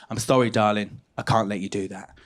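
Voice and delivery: low, soft motherly refusal; imitating a low-voiced Essex mum. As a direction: let the child down gently, voice dropped. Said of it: "And then she said".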